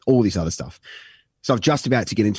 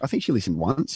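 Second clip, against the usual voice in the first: normal talking voice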